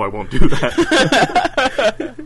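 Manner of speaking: laughing through a slow, high I won't do that; laughing refusal. Real, not acted. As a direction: refuse it while cracking up.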